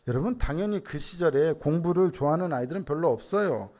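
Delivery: normal talking voice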